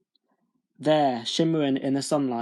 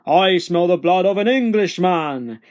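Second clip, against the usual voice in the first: loud, raised giant roar; thundering storybook giant declaration; 3 semitones higher, 6 dB louder than usual. A narrator's reading. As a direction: bellow it on the chase. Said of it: "roared the giant".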